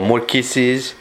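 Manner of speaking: ordinary talk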